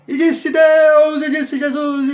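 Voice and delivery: hammed-up shouting preacher, slow and high; Silas Malafaia. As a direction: belt it out like a revivalist, stretching every word. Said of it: "Eu poderia ser um Silas Malafaia". (pregador aos gritos, imitação exagerada)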